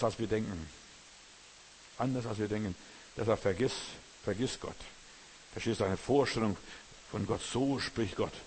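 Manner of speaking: normal talking voice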